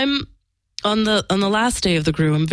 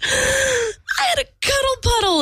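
breathless, much higher and slow, glowing with joy; beaming gasp; slower than usual. Retold or imitated. Friend bursting out of the room, beaming and gasping with delight. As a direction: gush it like a thrilled girl who just gasped. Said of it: "like, beaming".

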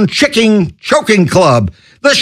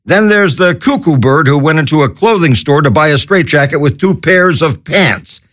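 normal talking voice

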